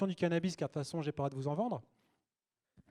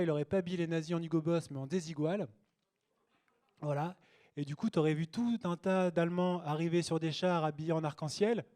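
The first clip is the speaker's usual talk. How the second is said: ordinary delivery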